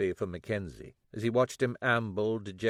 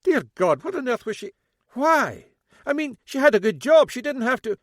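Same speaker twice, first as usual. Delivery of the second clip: high, loud Scottish father in disbelief; aghast dad, voice raised; lightly voiced Scottish father, shocked; 13 semitones higher, 9 dB louder than usual. A narrator's reading. Mackenzie shocked at daughter's lies.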